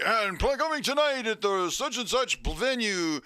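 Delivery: mock radio promo read. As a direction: sell the gig like a DJ. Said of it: "they're like".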